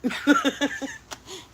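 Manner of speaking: high nervous laughter; nervous giggle after spotting a spider. Real, not acted. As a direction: laugh off the fright.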